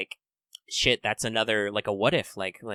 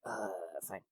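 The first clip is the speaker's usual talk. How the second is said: much softer, lower, grudging "fine"; deflated mumble; 6 semitones lower, 19 dB quieter than usual. Retold or imitated. Ghost lady giving up and leaving, soft grumble. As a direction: give in with a sulky sigh. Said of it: "she's like".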